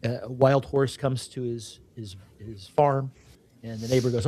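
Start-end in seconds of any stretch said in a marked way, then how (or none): none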